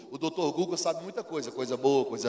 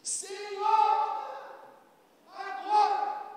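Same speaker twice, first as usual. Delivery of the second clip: high, insistent begging; impatient believer; much slower than usual. Acted. Believer pressing the Lord, high and insistent. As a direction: demand it right now, pitched up.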